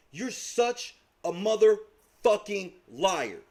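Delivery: drawn out, word by word; slow, emphatic accusation. Real, not acted. Accusing the man who blocked him of lying, slow and emphatic. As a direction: space out each word for weight. Accusation said slowly, then repeated faster.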